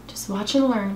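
ordinary talk

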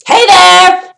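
loud, high demo of all-caps yelling; mock yelling. Shown as a demonstration. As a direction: shout it like a text in caps. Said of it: "you're yelling".

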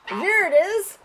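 bright high exclaim; pleased the cat looked up; delighted. Real, not acted.

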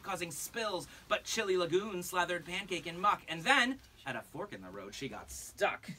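lilting rhymed read-aloud; singsong picture-book reading; storybook narration. Acted. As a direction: read it to a room of kids.

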